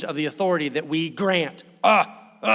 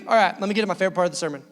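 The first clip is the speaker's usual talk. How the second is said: ordinary talk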